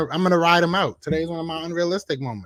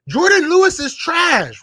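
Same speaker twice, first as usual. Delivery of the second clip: loud and higher, slow, dead set against him; outraged yell; 13 semitones higher, 10 dB louder, slower than usual. Real, not acted. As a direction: yell it in disbelief at the chat. Heated argument with chat.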